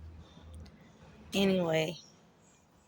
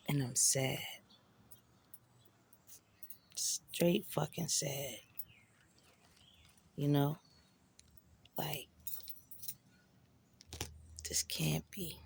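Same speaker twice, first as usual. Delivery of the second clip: hushed sadness; 2 semitones lower, 6 dB quieter than usual. Real, not acted. Waking up on operating table.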